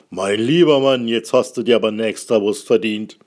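sehr laut, andere Stimme, jovial; lauter Würstchenverkäufer. Acted. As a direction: wie ein Budenverkäufer über den Platz rufen. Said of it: "sagt der Verkäufer". (Verkäufer ruft laut und anerkennend)

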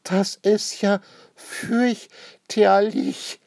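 high, loud, drawn-out horror; appalled. A narrator's reading. Lady sees her newly painted house, appalled. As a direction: stretch each word out in shrieky dismay. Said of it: "sie freut sich überhaupt nicht".

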